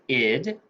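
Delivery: "id" said as a short clear syllable; suffix sound id. Shown as a demonstration. Teacher showing students the first ED sound, clearly. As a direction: sound out "id" for the class. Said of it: "Our suffix E D has three sounds".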